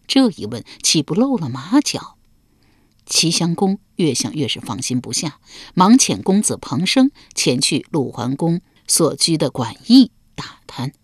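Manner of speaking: plain reading voice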